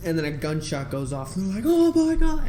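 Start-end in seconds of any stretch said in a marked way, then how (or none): 1.7-2.4 high, loud imitated alarm; mock shock. Onlookers when a gunshot goes off, loud mock shock. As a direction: cry out in exaggerated shock.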